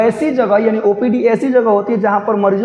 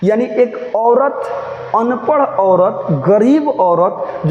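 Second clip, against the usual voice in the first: each phrase drawn out very slow with pauses; teacher stressing औरत, अनपढ़ औरत, गरीब औरत slowly; slow emphatic list; much slower than usual. Shown as a demonstration. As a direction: lay each word down slowly to stress her plight. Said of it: "अब समझिएगा बाबू ध्यान से".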